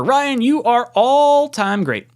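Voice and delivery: high, loud, slow hype; drawn-out hyped reading; hyped praise. Pretend. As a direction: read it like a rally cry.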